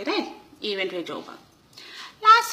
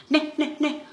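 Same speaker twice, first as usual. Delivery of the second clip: mock rooster; 6 semitones higher than usual. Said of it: "one rooster is crowing".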